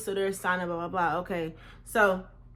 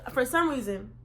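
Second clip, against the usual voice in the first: ordinary talk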